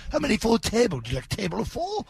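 a hint of a British accent; imitating British waiter. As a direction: play the polite London pub host. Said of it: "he was like".

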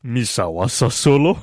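full put-on alien crime boss; best effort alien voice, then laugh; Jabba the Hutt. Host showing best impression, committed alien voice. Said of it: "You want the best I can".

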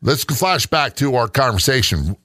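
ordinary talk, nothing marked

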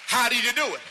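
high, loud sermon hammering home; preaching at full force. Acted. Pastor exhorting the church to be strong, loud and high. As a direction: drive the verse home with fire.

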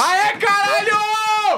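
drawn-out, high celebratory yell; elated cheer. Real, not acted. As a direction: cheer like your favourite just got announced. Cheering a favourite act announced.

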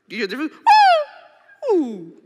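usual speaking voice

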